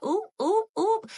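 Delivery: squealed high oop sounds; high playful oops. Real, not acted. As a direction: chirp it up high. Playful cheer on her look.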